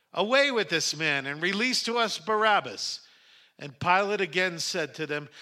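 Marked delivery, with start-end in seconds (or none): 0.1-3.0 raised, higher and louder voice; crowd calling out. A narrator's reading. Crowd demands Pilate release Barabbas, calling out. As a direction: call it out as one angry crowd. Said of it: "they called out together".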